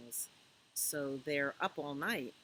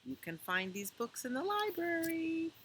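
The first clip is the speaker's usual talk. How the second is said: ordinary delivery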